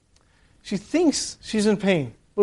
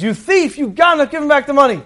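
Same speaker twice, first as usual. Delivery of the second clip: raised, higher-pitched storyteller's rebuke; acted accusation; 7 semitones higher, 5 dB louder than usual. Rebbe confronts the lying partner, accusing. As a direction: call out the thief, loud and high.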